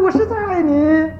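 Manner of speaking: high, slow, drawn-out call. Retold or imitated. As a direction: stretch it out like a child calling into the hills. Child calling out into the mountains. (模仿孩子拉长声音高喊)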